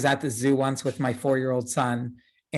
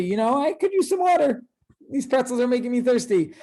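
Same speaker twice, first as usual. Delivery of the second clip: chirpy, lifted line reading, pitched up high; cheerful, light; 8 semitones higher than usual. Retold or imitated. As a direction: say it happy and carefree, with a laugh. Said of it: "fairly happy".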